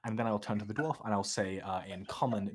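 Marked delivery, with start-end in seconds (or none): none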